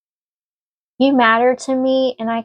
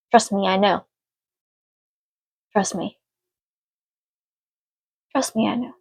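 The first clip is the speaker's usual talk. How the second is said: getting emotional; 4 dB quieter than usual. Real, not acted. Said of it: "before I get emotional".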